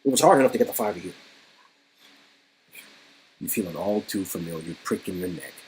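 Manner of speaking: ordinary talk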